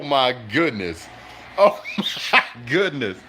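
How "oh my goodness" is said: breaking into laughter mid-phrase; laughing disbelief. Real, not acted. Fan reacting to a crazy fumble, laughing. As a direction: laugh through the words in amazement.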